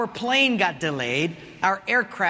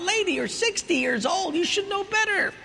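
scolding thought; 10 semitones higher than usual. Retold or imitated. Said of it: "And I thought".

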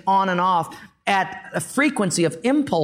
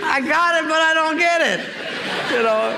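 laughing; 9 semitones higher than usual. Real, not acted. Preacher to congregation, speaking through laughter. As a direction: still chuckling as you talk.

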